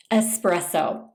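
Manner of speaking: ordinary delivery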